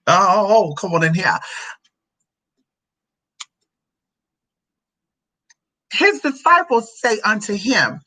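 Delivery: slow, declamatory Bible reading; scripture reading. Acted. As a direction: read the verse out grandly, drawing out key words. Reads scripture aloud.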